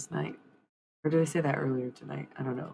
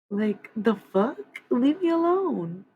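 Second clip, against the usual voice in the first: high, loud, outraged "leave me alone"; a sharp exasperated shout at an invisible tormentor; indignant yell; 7 semitones higher, 7 dB louder, slower than usual. Real, not acted. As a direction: snap back at whoever is hurting her. Yelling at an unseen attacker.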